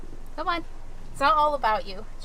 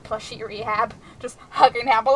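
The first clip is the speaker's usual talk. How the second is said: ordinary talk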